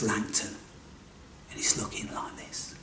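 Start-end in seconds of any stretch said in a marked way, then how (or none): 1.5-2.7 barely-there hushed aside; whisper. A narrator's reading. Storyteller shows viewers the old man's look, whispered. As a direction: drop to a bare whisper.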